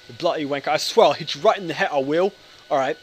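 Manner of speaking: hammed-up English threat; mock English accent. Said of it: "That's not even an English accent".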